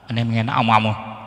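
ordinary talk, nothing marked

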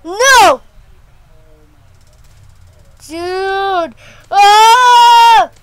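high, loud panicked screams; screaming. Real, not acted. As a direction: scream in frustration. Losing in game.

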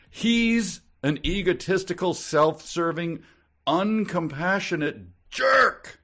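slow, loud, high-pitched mock fury; all-caps outrage. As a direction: hammer out each insult in capital letters. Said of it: "All caps".

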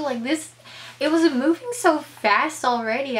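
ordinary talk

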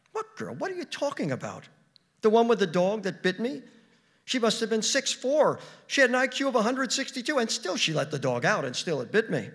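high-pitched, loud protest; indignant. Acted. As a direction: argue back, voice leaping up. Acted quarrel, wife protests.

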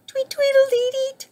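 very high, tweeting bird voice; twittering bird. A narrator's reading. Bird joins the band, high twitter. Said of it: "twittered Bird".

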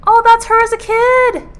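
high, loud burst of excitement; excited squeal. Real, not acted. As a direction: squeal it out in delighted surprise. Excited reaction to manga flashback.

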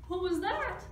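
high-pitched startled question. A narrator's reading. Cats hearing another spooky sound, startled. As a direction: squeak it in mock alarm.

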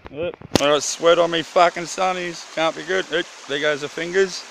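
usual speaking voice

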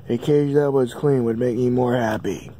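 normal talking voice

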